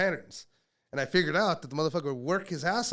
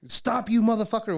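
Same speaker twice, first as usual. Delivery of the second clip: loud, higher-pitched barked command; yelled order; 8 semitones higher, 9 dB louder than usual. Retold or imitated. Handler yelling at his partner to stop, imitated yell. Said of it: "I yell at you".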